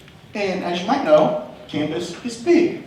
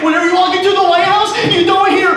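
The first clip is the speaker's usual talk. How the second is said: excited; 12 semitones higher, 8 dB louder, faster than usual. Real, not acted. Lead into chant, high and loud.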